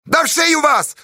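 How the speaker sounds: very high, furious cry; yell. A narrator's reading. Fedka driving off the men, furious yell. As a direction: yell to chase them off. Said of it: "заорал Федька".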